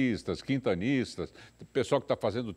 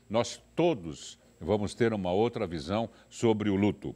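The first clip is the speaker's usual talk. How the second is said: usual speaking voice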